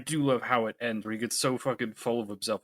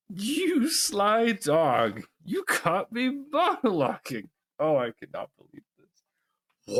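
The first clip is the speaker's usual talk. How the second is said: very slow, high gloating villain; slow theatrical villain menace; 8 semitones higher, 3 dB louder, slower than usual. Acted. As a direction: drag it out with smug glee. Second take of villain quote.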